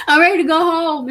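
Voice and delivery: high laughing whine; laughing while half-whining. Real, not acted. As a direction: laugh it out, exasperated. Laughing at her own despair.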